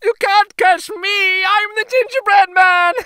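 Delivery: a cheeky cookie calling out to be chased; sing-song boast from a runaway gingerbread man; gingerbread boy taunt. Acted.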